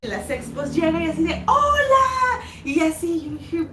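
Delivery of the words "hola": high, loud, stretched-out hello; imitated gushing greeting. Elida arriving at the expo, imitated gushing greeting. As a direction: call out a put-on effusive hello.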